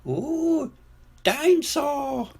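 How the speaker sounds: put-on very high, slow toddler voice; awed little George. A narrator's reading. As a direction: squeak it in drawn-out wonder. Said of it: "says George".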